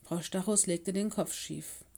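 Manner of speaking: ordinary delivery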